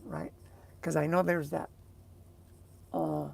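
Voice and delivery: ordinary delivery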